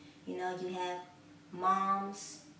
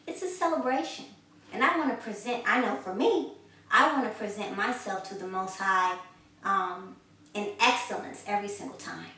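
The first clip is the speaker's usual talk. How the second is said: rising preaching fervor; 2 semitones higher, 5 dB louder than usual. Acted. Preacher rallying his listeners to celebrate, full-voiced. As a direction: lift the congregation, climbing toward celebration.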